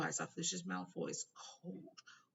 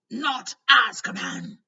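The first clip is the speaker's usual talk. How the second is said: loud, slow, high desperate croak; croaked cry; 8 semitones higher, 17 dB louder, slower than usual. A narrator's reading. Hagrid hearing of Azkaban, a loud slow croak. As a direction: force it out in panic.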